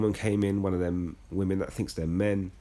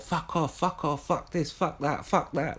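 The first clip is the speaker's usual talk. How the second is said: pitched-up hammed rant of fuck offs; higher mock rant; mock sweary rant; 7 semitones higher, slower than usual. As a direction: parody the supposed foul-mouthed outburst, higher. Mocking the alleged swearing rant.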